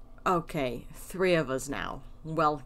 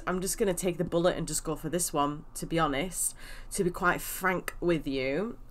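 ordinary talk

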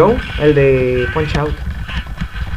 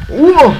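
sharp high yelp of surprise; startled yelp; 11 semitones higher, 7 dB louder than usual. Real, not acted. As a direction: yelp as the hit lands. Reacting to a hit in the game.

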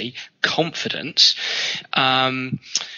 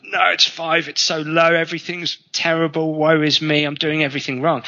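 high, whiny self-pity; mock woe-is-me whine; 5 semitones higher than usual. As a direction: ham up the misery. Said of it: "They're kind of like".

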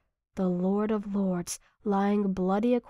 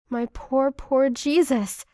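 aching, rising sorrow; sorrowful; 5 semitones higher, 4 dB louder than usual. A narrator's reading. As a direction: say it with grief, slowly. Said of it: "I say".

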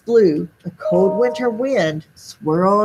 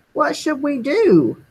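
put-on children crying out; 7 semitones higher than usual. A narrator's reading. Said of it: "The children cried out".

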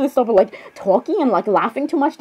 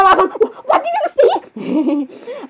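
high, drawn-out pretend shock; playful incredulous squeal; mock disbelief; 7 semitones higher, much slower than usual. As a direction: stretch it out in acted disbelief. Character reacts to overheard remark.

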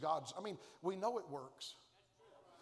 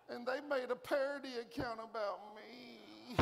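whiny Elijah; 4 semitones higher, 3 dB quieter than usual. Acted. Elijah voiced whining about tweets.

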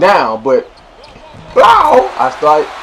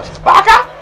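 high mock swish. Imitating a shot sound.

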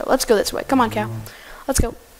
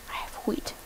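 whisper; 12 dB quieter than usual. Real, not acted. Whispered take of repeated line.

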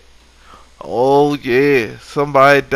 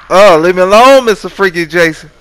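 mock plea; 6 semitones higher, 7 dB louder than usual. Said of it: "mock plea while attacked".

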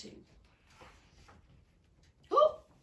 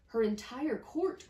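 slow, louder surprised echo; surprised question; 5 semitones higher, 7 dB louder than usual. Acted. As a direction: ask it back in amazement.

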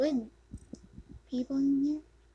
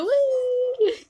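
very high, loud delighted whee; gleeful squeal; 12 semitones higher, 13 dB louder than usual. Real, not acted. Player squealing with delight at the game. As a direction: squeal with pure joy.

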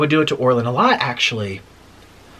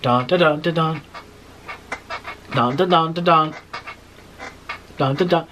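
bouncy sung-out "dun" beat; even, rhythmic dun-dun imitation; seesaw rhythm. Shown as a demonstration. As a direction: mimic a seesaw going up and down.

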